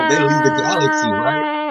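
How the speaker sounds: high, dreamy voice; spacey. Acted. Describing cats watching birds, high spaced-out tone. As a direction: float up like the cat is gone.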